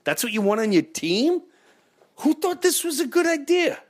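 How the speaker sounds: high-pitched indignant fan rant; rant, pitch climbing with outrage; escalating comedy rant. Real, not acted. Fan rants about bad trade.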